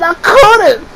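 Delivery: very high, squeaky-high mock protest; helpless protest. Me explaining to my friend, high helpless protest. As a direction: plead it high in self-mockery.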